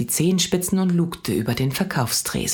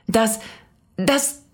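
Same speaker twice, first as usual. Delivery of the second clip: higher, halting nervous mother; 9 semitones higher than usual. A narrator's reading. Mama caught off guard, nervously stammering. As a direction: stammer it out, flustered. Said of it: "sagte Mama nervös".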